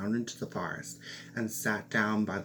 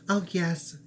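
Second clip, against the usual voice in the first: high, louder quick yes; eager princess; 7 semitones higher, 4 dB louder than usual. Acted. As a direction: jump at the offer, voice up high.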